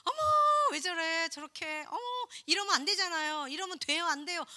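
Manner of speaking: a hint of a judging neighbor clucking her tongue; mocking gossip voice. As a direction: sneer it out like a busybody. Imitating a judgmental believer.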